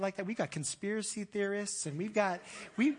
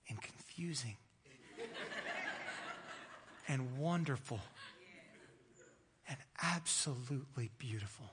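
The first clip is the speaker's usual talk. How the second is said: much softer and lower, sincere; heartfelt; 6 semitones lower, 11 dB quieter than usual. Real, not acted. Pastor sharing his love for a diverse church, soft and sincere. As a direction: speak from the heart, hushed.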